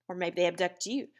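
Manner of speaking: ordinary talk, nothing marked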